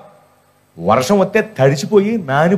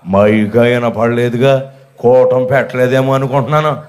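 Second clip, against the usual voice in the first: much lower, slow, put-on old woman's voice; old woman's reply; 8 semitones lower than usual. Preacher imitating the absent old woman, low and slow. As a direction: play the stubborn old lady, low and dragging. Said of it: "అంది".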